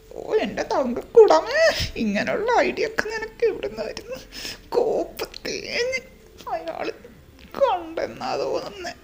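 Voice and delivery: hammed-up weeping to fool the uncle; fake crying. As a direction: wail it like a hammed-up mourner.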